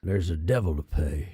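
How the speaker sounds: low, quiet movie-cowboy father; Sam Elliott-Sling Blade mashup. Acted. Father quoting a war film from above, low impression. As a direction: drop deep for a terrible film impression. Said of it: "doing a Sam Elliott or Sling Blade".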